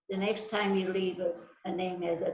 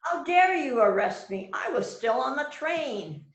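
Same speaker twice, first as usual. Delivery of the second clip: higher, louder outraged protest; indignant outburst; 6 semitones higher, 5 dB louder than usual. Acted. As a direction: hurl it back at the detective. Suspect protests her arrest.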